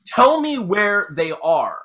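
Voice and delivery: slow, high, hammed-up interrogation; mock stern demand. Santa questioning his frightened elves, slow mock-stern demand. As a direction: draw out each word as a demand.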